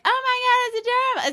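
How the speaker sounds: high, squealing mock excitement; passerby gushing over a robot dog; mock delighted gushing. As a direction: gush like a dazzled passerby. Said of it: "and be like".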